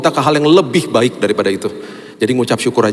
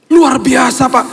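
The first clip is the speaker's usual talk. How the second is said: tearful driver; 13 semitones higher, 4 dB louder, much slower than usual. Retold or imitated. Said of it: "Dia, dia nangis".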